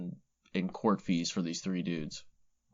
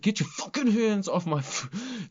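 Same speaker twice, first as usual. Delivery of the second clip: mock angry snarl; 11 semitones higher than usual. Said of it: "It's like".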